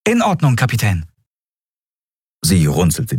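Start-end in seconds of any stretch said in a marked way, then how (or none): 0.1-1.1 put-on high boy voice; eager young lad; obedient boy. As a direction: acknowledge the captain brightly, starting high. Boy obeys the captain.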